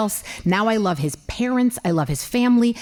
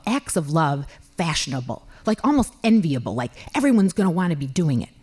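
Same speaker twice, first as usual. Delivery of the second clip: ordinary talk, nothing marked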